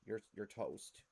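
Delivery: usual speaking voice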